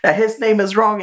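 high, mock furious mom tirade, laughing through it; angry mom. Son imitating mom confronting the teacher, mock outrage. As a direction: rant like a mom storming the school.

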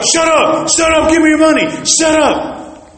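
high, agitated shouted demand; robber's angry order. Retold or imitated. Robber silencing the praying clerk, angry high shout.

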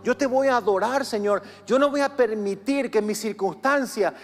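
loud, high impassioned preaching; preaching at full force. Real, not acted. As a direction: preach it with fire. Predicación a plena voz. (predicando a plena voz)

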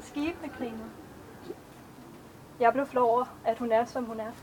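measured, even poetry recitation; a poet reading her own verses to an audience; poem reading. Acted. Author reads her poems aloud.

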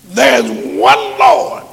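normal talking voice

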